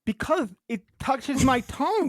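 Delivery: high, slow, tongue-tied lisp through vampire teeth; lisping on fake teeth. Real, not acted. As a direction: lisp "Because it touches my tongue", dragging it out. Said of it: "why are you talking so weird".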